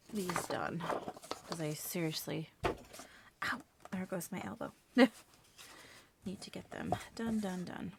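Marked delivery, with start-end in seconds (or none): none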